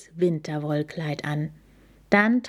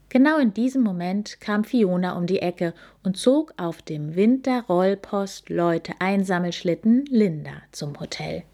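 ordinary delivery, nothing marked

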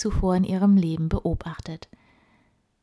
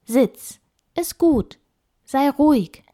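firm raised voice for the dog; firm command; 7 semitones higher, 7 dB louder than usual. Acted. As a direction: tell the dog off firmly. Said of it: "sagte sie energisch".

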